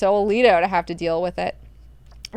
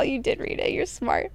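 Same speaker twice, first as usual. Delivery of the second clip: fond whisper; 4 semitones higher, 5 dB quieter than usual. Retold or imitated. Leto fondly praises Hwi.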